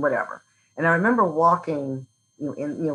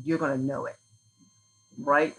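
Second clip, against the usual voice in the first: usual speaking voice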